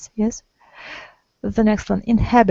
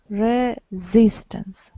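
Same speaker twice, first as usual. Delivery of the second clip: "Resistance" drawn out slowly, leaning on sis; slow stress model; much slower than usual. Shown as a demonstration. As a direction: stretch "Resistance" slowly to show the second syllable. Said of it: "The second".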